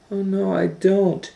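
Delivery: put-on high child voice; Kate refusing. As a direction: pipe up in protest.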